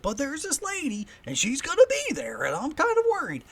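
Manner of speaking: very high, worried oddball; Wild Bill impression. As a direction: fret loudly in an eccentric character's high voice. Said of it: "I'm doing a Wild Bill impression".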